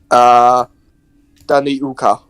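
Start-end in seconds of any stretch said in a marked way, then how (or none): 1.5-2.2 "Dani Uka" with a Polynesian-like sound. Shown as a demonstration. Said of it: "That sounds Polynesian".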